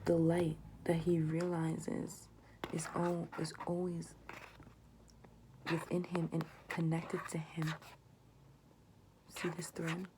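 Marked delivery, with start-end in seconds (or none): 2.7-10.1 breathy whispered tarot reading, slowed; soft whisper. Acted. Tarot reader whispering the card meanings to viewers. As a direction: draw them in with a hushed voice.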